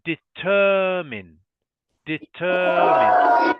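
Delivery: slow, loud determine, stress on the second syllable, de-TER-mine. Shown as a demonstration. Teacher models the stress for the student: slow de-TER-mine. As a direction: lean hard on TER in determine, very slowly. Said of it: "the stress or the emphasis is on the second syllable".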